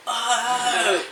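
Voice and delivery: excited exclamation. Retold or imitated. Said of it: "he was like".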